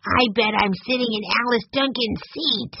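put-on high-pitched child voice; wide-eyed young boy. A narrator's reading. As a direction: say it as an awed little boy. Wide-eyed boy realizes whose seat.

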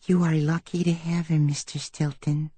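soft, hushed high voice; whisper. A narrator's reading. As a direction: whisper confidingly, fading away. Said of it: "Mousella whispered".

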